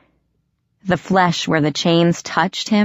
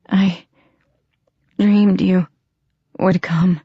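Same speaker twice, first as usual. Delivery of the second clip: murmured. Acted. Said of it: "he murmured".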